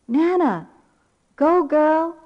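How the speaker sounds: put-on high, loud little-girl cheer; imitated child's shout. Granddaughter shouting out to Nana, imitated shout. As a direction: shout out encouragement like a small child. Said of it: "I heard her shout out".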